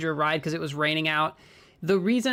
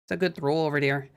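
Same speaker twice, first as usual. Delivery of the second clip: lightly put-on Minnesota accent. Shown as a demonstration. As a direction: demonstrate Minnesotan speech with round vowels. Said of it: "They say, "Oh".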